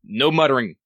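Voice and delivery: stern reprimand. Real, not acted. Game leader telling muttering players off, stern. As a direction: put a stop to the chatter.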